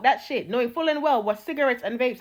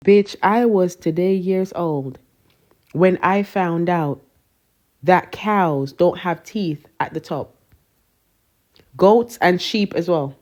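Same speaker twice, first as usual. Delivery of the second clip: lower, slow, weighty reveal; dramatic announcement; 6 semitones lower, 4 dB louder, slower than usual. Real, not acted. Telling followers a shocking fact, slow and grave. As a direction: drag it out like breaking big news.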